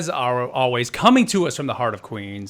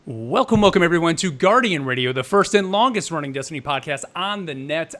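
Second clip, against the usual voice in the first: show intro; 3 semitones higher, 4 dB louder than usual. Acted. Podcast host opening intro.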